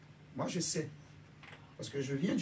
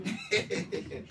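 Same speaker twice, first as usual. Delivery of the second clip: laughing; 4 semitones higher than usual. Real, not acted. Laughs after "amen, amen.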